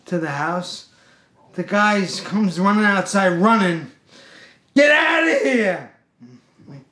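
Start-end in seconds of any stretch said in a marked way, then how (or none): 4.7-5.9 high, loud drawn-out yell; angry shout. Retold or imitated. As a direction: imitate a man yelling someone off his property. Customer runs out yelling.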